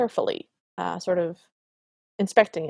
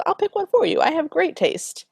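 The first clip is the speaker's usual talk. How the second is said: mock king; 8 semitones higher than usual. Father pushing his son, voiced high and breezy. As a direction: play the vain king, pitched up high. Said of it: "And the king goes".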